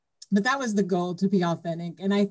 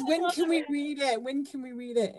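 put-on eager little girl; 7 semitones higher, 5 dB quieter than usual. Kid eager to read book.

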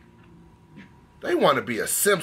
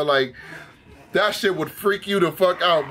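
usual speaking voice

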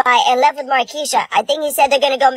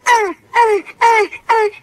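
fake wailing; 7 semitones higher than usual. Kid wailing after dad's reaction.

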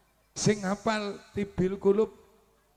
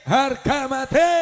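loud, raised chanted response; slogan shout; 5 semitones higher, 9 dB louder than usual. Real, not acted. Answering the speaker's slogan, rallying shout. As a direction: answer the rallying call at full voice.

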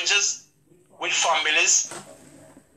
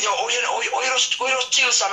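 higher imitated friend, lightly voiced; friend's voice; 9 semitones higher, faster than usual. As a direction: pitch it up a little as a laid-back friend. Friends tell him to chill.

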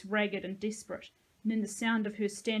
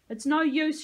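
loud, high despairing cry; screamed; 7 semitones higher, 9 dB louder than usual. A narrator's reading. Sahalia gives up in the pit, screamed. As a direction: scream it out hopelessly. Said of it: "she screamed".

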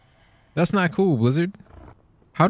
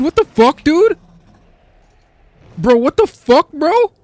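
real high, loud disbelief; exasperated yelling; 7 semitones higher, 9 dB louder than usual. Real, not acted. Trapped player wants out, exasperated yelling. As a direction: yell at the game, pitch climbing higher and higher. Stuck in a game glitch.

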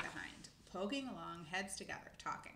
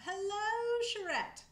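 very high, loud hailing greeting; calling out; 13 semitones higher, 10 dB louder, slower than usual. A narrator's reading. Old squirrel hails her as she scampers by, calling out. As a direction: holler a long hello to her. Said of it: "he called out".

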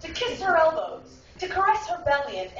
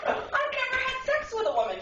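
mother's voice; 10 semitones higher, faster than usual. Retold or imitated. Mother's reply retold.

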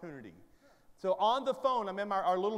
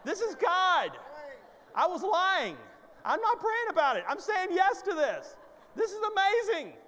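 high, loud, over-the-top excitement; elated shouting; 11 semitones higher, 5 dB louder than usual. Acted. Husband insisting he needn't pray about the job, elated shouting. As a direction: proclaim it at full pitch, hammed-up.

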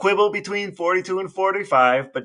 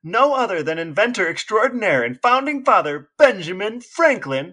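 louder, slower showman build-up; grand announcement; 5 semitones higher, 3 dB louder than usual. Acted. Brother introducing Benjamin Franklin like a showman, grand announcement. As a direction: present him like a ringmaster.

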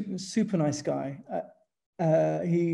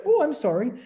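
higher, louder mock apology; apologetic English; 7 semitones higher, 5 dB louder than usual. Retelling how he apologises to locals, mock meek sorry. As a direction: overdo the polite English sorry. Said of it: "every time I say".

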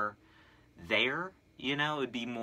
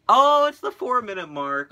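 excited shout; 10 semitones higher, 14 dB louder than usual. Real, not acted. Reaching the four minute mark.

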